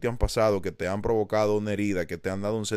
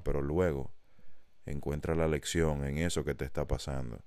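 calm guidance; 6 semitones lower, 5 dB quieter than usual. Acted. Telling a struggling viewer to sit and breathe, soothing and soft. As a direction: ease them into breathing and resting.

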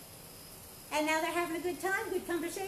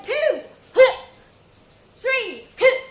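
loud, high counted shouts; loud karate count and kiai; 10 semitones higher, 15 dB louder, much slower than usual. Shown as a demonstration. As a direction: shout each count with the punch. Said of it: "the voice is very important".

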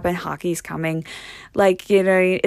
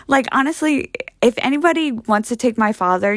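ordinary delivery

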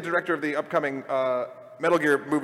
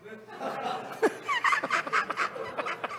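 silent laugh; 5 dB quieter than usual. Real, not acted. After the The Room joke.

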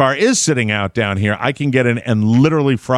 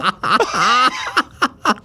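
high, helpless laughter; laughing fit; 12 semitones higher than usual. Real, not acted. Host loses it at the co-host's curl question, high laughter. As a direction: keep cracking up, pitched high. Cracking up over the curl joke.

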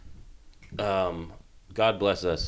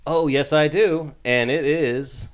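mock enthusiasm; 7 semitones higher, 6 dB louder, slower than usual. Fakes having an episode ready.

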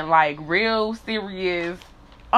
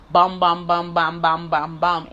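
sliding sound effect; 2 semitones lower, slower than usual. Retold or imitated. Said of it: "he just was like".

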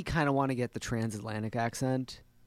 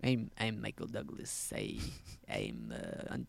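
Michael Douglas impression; 14 semitones lower, 10 dB quieter than usual. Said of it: "I could get a good Michael Douglas".

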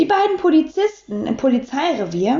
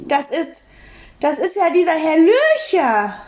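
halting, stumbling start with a higher voice; stammering; 7 semitones higher than usual. A narrator's reading. Taschenbier stammers on seeing his neighbour at the door. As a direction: stammer it out in shock. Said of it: "stammelte Herr Taschenbier".

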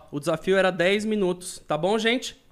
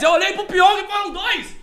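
high, slow, insistent repeat; emphatic repeat; 12 semitones higher, 4 dB louder than usual. Real, not acted. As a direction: say it again, high and drawn out. Insists on the count.

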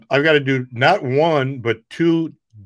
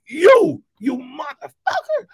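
high furious yelling at the comic; screaming rage at the man who mocked him; imitating a furious heckler screaming; angry scream; 11 semitones higher than usual. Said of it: "he's screaming".

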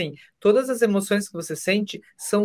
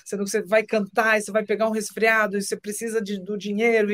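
higher, nagging partner voice; mock scolding Isabela; 4 semitones higher than usual. Guest imitating his partner scolding him, higher pitched. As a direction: imitate her fussing at you. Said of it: "a Isabela briga comigo".

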